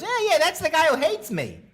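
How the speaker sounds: high put-on voice; mock Leno. Leno pointing out the comic, high put-on voice. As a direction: play Leno amused. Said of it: "and he goes".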